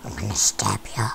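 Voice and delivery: deep, menacing creature growl; Herobrine monster voice. Acted. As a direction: threaten them from way down low. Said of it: "Oh, that's the wrong voice".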